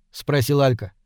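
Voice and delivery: plain reading voice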